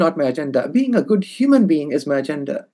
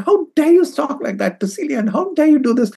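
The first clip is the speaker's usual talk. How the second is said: high-pitched mock outrage; retold indignant scolding; 10 semitones higher than usual. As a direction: jump in to scold, acting out others' indignation. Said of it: "they all jumped at her and said".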